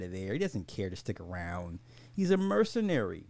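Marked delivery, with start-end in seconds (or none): none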